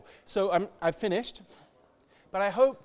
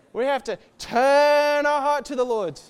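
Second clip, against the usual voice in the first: higher and louder, a strong call; loud preached call; 6 semitones higher, 7 dB louder than usual. Real, not acted. Preacher urging the congregation, a loud call. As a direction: call it out to the room.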